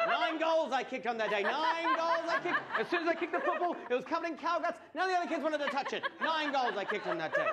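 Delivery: loud, high, laughing boast; triumphant. Real, not acted. Comedian bragging to the crowd about his goals, loud laughing triumph. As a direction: crow about it with glee.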